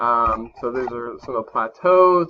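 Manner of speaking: usual speaking voice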